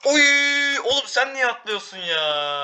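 high, loud cry then slow scolding question; exasperated yell at teammate. Real, not acted. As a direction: yell at your teammate for jumping off, then drag out the complaint. Teammate jumps, player exasperated.

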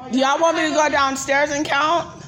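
normal talking voice

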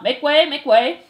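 loud, slow crowd-clearing call. A narrator's reading. Threadmaster clearing a path through the mice, calling out. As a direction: call it out loudly, drawing the words out. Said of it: "the threadmaster calling out".

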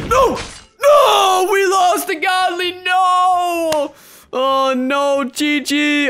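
high, very loud shout of loss; despairing shout. Real, not acted. Gamer losing the duel, very loud despairing shout. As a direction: howl at losing the prize.

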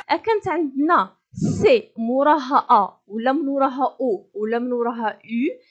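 ordinary delivery

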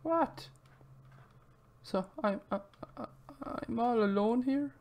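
small, high, soft abandoned voice; hesitant little voice, high and quiet; mock forlorn. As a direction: play the pitiful one left behind. Learns friend left them alone in game.

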